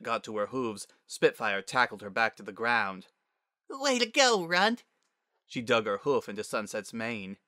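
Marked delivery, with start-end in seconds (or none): 3.7-4.8 put-on high young girl's cheer; squeaky, playful congratulation; teasing kid sister. A narrator's reading. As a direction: tease your sibling in a high child voice. Sister tackles and teases Sunset.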